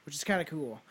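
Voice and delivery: ordinary talk, nothing marked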